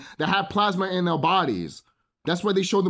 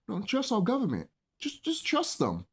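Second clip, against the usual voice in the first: higher, softer mimicking voice; mock naive voice; 5 semitones higher, 5 dB quieter than usual. Imitating the other side of people, mock naive and high.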